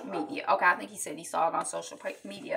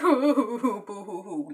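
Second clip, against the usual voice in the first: mocking laugh; 3 dB louder than usual. [name] mockingly laughs at Jeff.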